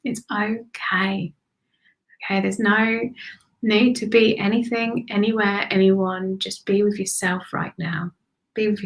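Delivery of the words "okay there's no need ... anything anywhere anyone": usual speaking voice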